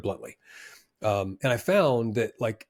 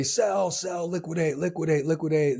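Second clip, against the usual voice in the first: panicking trader; 5 semitones higher than usual. Retold or imitated. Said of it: "is panicking".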